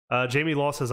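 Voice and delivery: ordinary talk, nothing marked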